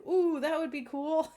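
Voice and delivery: thrilled ooh. Retold or imitated. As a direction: gush like a kid hearing a cool idea. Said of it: "And he's like".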